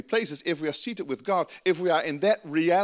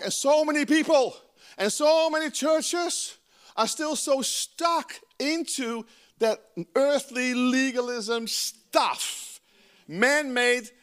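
fervent preaching; 7 semitones higher, slower than usual. Real, not acted. Preacher building on stuck churches.

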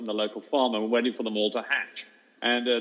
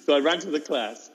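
ordinary delivery, nothing marked

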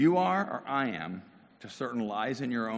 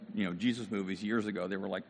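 usual speaking voice

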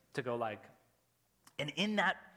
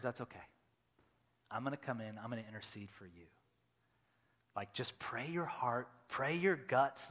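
reassuring Spirit; 4 semitones lower, 4 dB quieter than usual. Retold or imitated. Spirit answers the anxious prayer.

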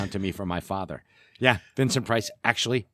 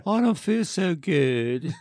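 slow, high, fading weak voice; Peter Parker voice; 7 semitones higher, slower than usual. Retold or imitated. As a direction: drag it out like you're fading. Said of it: "And he's like".

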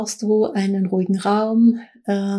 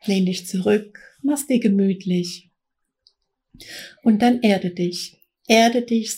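right in your ear. Acted. Meditation opening, close to the mic.

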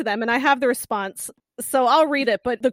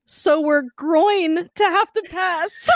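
slow deadpan read; 4 semitones higher, much slower than usual. Acted. Reading pun letter slowly.

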